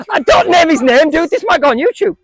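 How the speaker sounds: loud, high, amused scolding; playful protest. Real, not acted. Coach stops a kid naming names for YouTube, playful protest. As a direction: cry out in mock alarm after a laugh. Child named a teammate on camera.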